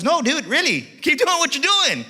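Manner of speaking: high, loud, drawn-out urging; insistent shout. Retold or imitated. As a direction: holler it like you really mean it. Salesman insists, calling across the lot.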